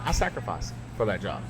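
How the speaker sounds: normal talking voice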